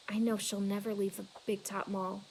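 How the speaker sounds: plain reading voice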